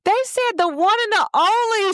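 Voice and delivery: high-pitched gushing; hyped-up friend. Retold or imitated. Friend recording her walk across the stage, high and gushing. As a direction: cheer in a very high voice. Voices friend cheering at graduation.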